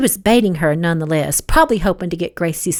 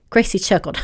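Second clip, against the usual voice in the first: ordinary delivery, nothing marked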